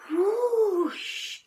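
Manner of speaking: big gusting whoosh; loud whoosh. A narrator's reading. Storm arriving with a loud whoosh. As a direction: blow it out like wind.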